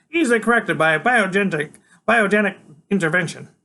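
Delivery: put-on high, loud, lofty kid genius; Freak's voice. A narrator's reading. Freak's character voice continues.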